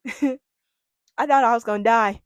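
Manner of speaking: slow, loud, laughing through the words. Real, not acted. Telling viewers about her fear, loud slow laughter. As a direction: laugh it out as you admit it. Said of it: "laughing while telling".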